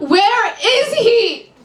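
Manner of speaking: desperate client. Acted. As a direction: beg for the answer now. Client repeats, more desperate.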